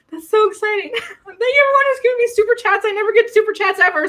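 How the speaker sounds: high, fast and giddy; excited gushing. Real, not acted. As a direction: gush in delighted disbelief, with a laugh. Overwhelmed by viewers' super chats.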